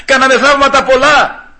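high, insistent protesting voice; pleading crowd. Acted. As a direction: act out many people pleading their case. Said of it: "θα πούνε".

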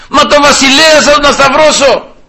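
high-pitched, incredulous challenge; protesting cry. Acted. Pilate challenges the crowd over their king, crying out.

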